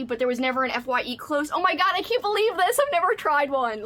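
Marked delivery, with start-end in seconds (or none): 1.5-3.8 higher, thrilled disbelief; real delight; excited. Real, not acted. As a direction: gush about the gift. Finding the Reptar bars.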